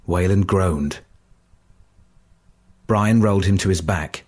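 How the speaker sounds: plain reading voice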